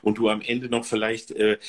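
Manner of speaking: ordinary talk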